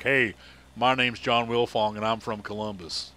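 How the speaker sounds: imitated Southern Ohio drawl, slow vowels; Southern Ohio accent. Introducing himself as from Columbus, mimicked Southern Ohio accent. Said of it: "a little bit more of the Southern Ohio".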